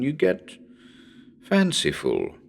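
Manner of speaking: ordinary delivery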